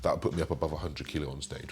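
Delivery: ordinary delivery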